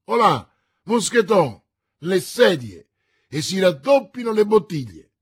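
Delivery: richiamo forte e più alto al servo; chiamare il servo. A narrator's reading. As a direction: chiamalo dall'altra stanza. Chiama il servo a voce alta. (Porthos chiama Mousqueton, a gran voce)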